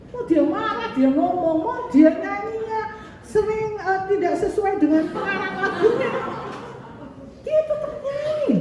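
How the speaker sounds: high, lively, rising with delight; excited. Real, not acted. Preacher excitedly praising Pak Parmin's singing, high and lively. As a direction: build up admiration eagerly.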